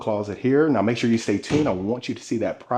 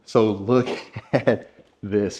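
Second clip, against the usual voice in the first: laughing; slower than usual. Real, not acted. Laughing while showing a room.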